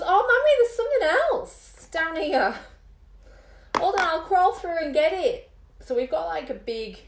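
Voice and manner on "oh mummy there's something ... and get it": put-on high, eager little girl voice; daughter's voice. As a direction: chirp it up like a young daughter. Voicing her daughter.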